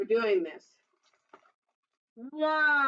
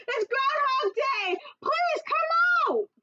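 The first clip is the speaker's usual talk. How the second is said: much higher, loud crowd call; shout; 3 dB louder, slower than usual. Acted. Town crowd shouting to the groundhog, loud and high. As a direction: yell it up to the burrow. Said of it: "said with a shout".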